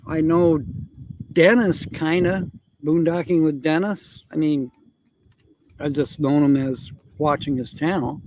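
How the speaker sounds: usual speaking voice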